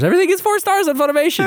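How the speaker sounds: high mock voice. Joking about inflated ratings.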